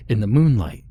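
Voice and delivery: usual speaking voice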